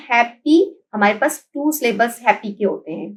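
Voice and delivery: ordinary talk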